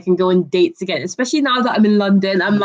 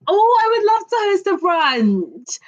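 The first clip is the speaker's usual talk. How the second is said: much higher pitch, thrilled yes; delighted acceptance; 9 semitones higher than usual. Real, not acted. Host answers a caller's request to host a brunch, delighted. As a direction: gush with delight at the idea.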